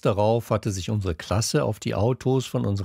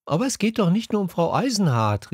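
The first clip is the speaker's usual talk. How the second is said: high, fast protest; calling out; 9 semitones higher, faster than usual. A narrator's reading. Pink cries out to the group, high and urgent. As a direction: Pink calls out over the others, high and fast. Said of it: "rief Pink".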